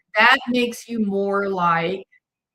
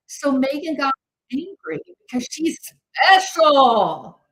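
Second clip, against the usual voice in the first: slow, loud, higher sarcasm; sarcastic; 4 semitones higher, 5 dB louder than usual. Real, not acted. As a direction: drip with sarcasm. Sarcastic jab at Meghan.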